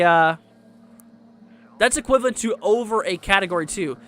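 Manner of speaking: talking loud over background noise; yelling over leaf blower. Real, not acted. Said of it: "Sorry about the leaf blower behind me".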